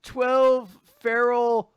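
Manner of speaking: high, very slow pun punchline; loud put-on voice milking the pun; hammed-up punning. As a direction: draw out each word of the corny joke. Playing Asta forcing another tree pun.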